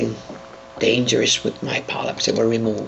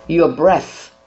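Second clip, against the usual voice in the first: ordinary delivery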